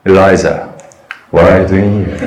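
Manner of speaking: low, soft and tender; gentle, tender. Shown as a demonstration. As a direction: ask gently, like a caring father. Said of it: "이 번".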